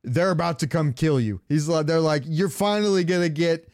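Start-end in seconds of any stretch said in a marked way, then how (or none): none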